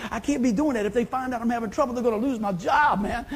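ordinary delivery

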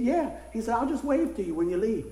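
usual speaking voice

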